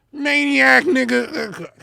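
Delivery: high, loud mock of maniac; mocking repeat. Mocking the rapper's word choice, high mocking repeat. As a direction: scoff the word back at him.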